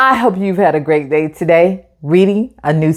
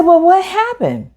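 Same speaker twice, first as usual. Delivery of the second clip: a lightly higher mom asking what happened; mom's voice; 11 semitones higher than usual. Acted. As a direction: mom gently asks her son what went wrong. Teacher voices the mom in story.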